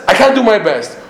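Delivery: high-pitched mock excuse; acted complaining objection; protesting objector. Voices an objecting student.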